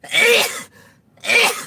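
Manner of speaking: loud high sneeze and cough; sneezing. Real, not acted. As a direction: sneeze hard. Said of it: "About to sneeze".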